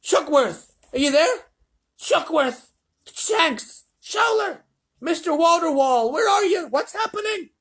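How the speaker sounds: high, loud, anxious shouts; frantic calling. A narrator's reading. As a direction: call out names in rising panic. President calls missing astronauts.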